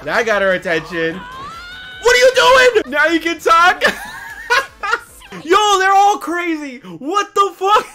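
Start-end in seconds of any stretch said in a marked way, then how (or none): 1.2-5.4 high, drawn-out shout breaking into laughter; real loud, high, amused outcry; shocked yelling. Real, not acted. Yells at character's shocking move. 5.4-7.9 loud, high disbelief ending drawn out; real high-pitched amused shock; amazed shouting. Real, not acted. Shocked, laughing at characters' antics.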